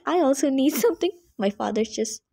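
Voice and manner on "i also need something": fast, louder cheeky request with a laugh; giggling quick whine; laughing plea. Retold or imitated. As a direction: jokingly beg for a gift too. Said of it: "I was like".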